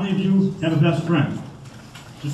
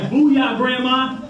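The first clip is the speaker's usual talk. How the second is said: taunting booyah; 9 semitones higher, much slower than usual. Retold or imitated. Driver mocking the car he just passed, high taunt. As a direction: rub it in at the slow driver.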